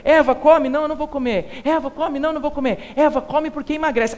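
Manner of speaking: pushy, wheedling tempter; coaxing devil. Acted. Devil pestering Eva to eat, pushy wheedle. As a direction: nag her into eating.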